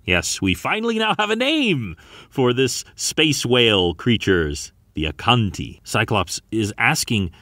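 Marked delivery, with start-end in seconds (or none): none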